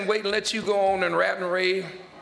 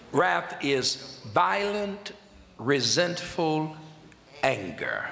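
measured pauses after each word; deliberate definition; 2 semitones lower, much slower than usual. Shown as a demonstration. Measured first reading of definition.

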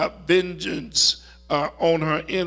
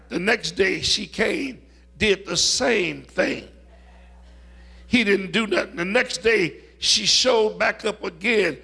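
usual speaking voice

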